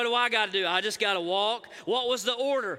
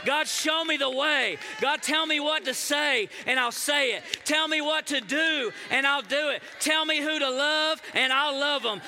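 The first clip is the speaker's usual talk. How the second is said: crying out to God; 4 semitones higher than usual. Acted. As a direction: beg God for orders at full force. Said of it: "start crying out and saying".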